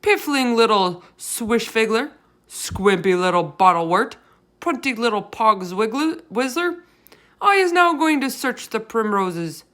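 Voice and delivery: loud, rising string of insults; shouted insults. A narrator's reading. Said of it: "he shouted".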